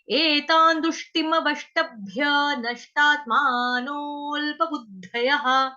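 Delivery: slow chanted Sanskrit line; verse recitation. Shown as a demonstration. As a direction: model the verse for the class. (धीमा श्लोक पाठ)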